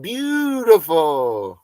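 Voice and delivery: slow, loud, high "beautiful"; stretched-out awed praise; drawn-out admiration. Real, not acted. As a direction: savor the word like a great movie scene. Savoring movie moment.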